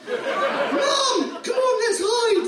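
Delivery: put-on boy urging mum to hide; urgent little boy. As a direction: shout it like a scared kid. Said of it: "the son said".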